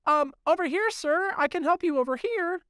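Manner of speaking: high nasal-free pipsqueak falsetto, overly polite; nerdy cashier voice. Retold or imitated. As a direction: play a prissy little clerk calling you over. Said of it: "He goes".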